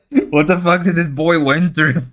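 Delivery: ordinary talk, nothing marked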